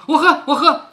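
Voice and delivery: narrated victim giving in at once; hasty submission. A narrator's reading. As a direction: blurt out a mock-desperate agreement. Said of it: "薛蟠连忙说".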